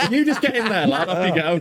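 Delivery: high, laughing mock builder urging him back in. Builder mocking the comedian back into the burning car, high laughing voice. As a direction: laugh through a cheeky builder's send-off. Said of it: "just like".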